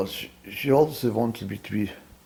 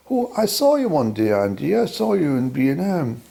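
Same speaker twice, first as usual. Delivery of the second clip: higher and louder as the imagined woman; imagined ex catching him out, higher and louder; mock woman's accusation; 3 semitones higher, 5 dB louder than usual.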